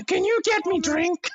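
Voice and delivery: put-on high, slow childish ask; little kid. A child asking the clan for a drink, high and slow. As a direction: ask like a small boy.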